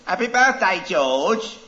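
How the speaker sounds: high, loud singsong wish, a touch of put-on voice; sing-song birthday greeting. Owner greeting her goldfish on its birthday, high singsong.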